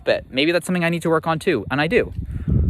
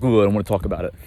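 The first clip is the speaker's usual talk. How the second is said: mocking dad; 5 semitones lower, much faster than usual. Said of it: "he's like".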